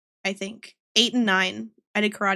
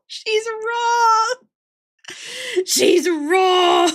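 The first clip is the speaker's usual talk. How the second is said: high wail close to tears; helpless laughter; 7 semitones higher, much slower than usual. Real, not acted. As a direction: wail it while cracking up.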